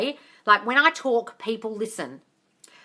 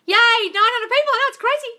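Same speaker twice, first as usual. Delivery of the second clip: high and loud, thrilled; excited; 12 semitones higher, 7 dB louder than usual. Real, not acted. Celebrating nine hundred viewers on the live, excited. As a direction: squeal over the viewer count.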